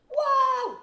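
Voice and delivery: high loud cheer like a thrilled fan; loud high delighted whoop. Retold or imitated. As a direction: whoop like a fan at the big fight. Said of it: "she was clapping and".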